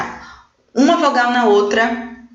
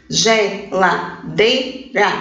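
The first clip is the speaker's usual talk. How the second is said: slow "geladeira" in four pieces, ge-la-dei-ra; syllable by syllable; 2 semitones lower, much slower than usual. Shown as a demonstration. Teacher counting syllables for pupils, "geladeira" slowly broken into ge-la-dei-ra. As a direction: pause between each syllable of "geladeira". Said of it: "ela tem quatro sílabas".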